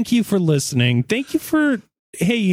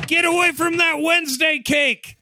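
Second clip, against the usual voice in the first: high sharp shout; shouting at cat; 13 semitones higher than usual. Real, not acted. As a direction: yell it at the cat. Yelling at the cat.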